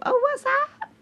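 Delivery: very high, cute greeting; flirty high squeak. Pretend. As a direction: coo it like a flirt.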